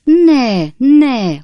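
loud, high, desperate protest; yelling. A narrator's reading. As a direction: cry it out to stop them. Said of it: "ordította Pickwick úr". (hangos, magas, kétségbeesett kiáltás)

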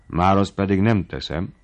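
plain reading voice

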